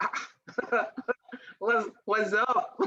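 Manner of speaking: breezy, laughing aside; mock casual. Shown as a demonstration. Contrasting the grave tone with a giggly casual one. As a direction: throw it away lightly, half-laughing. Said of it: "You're like".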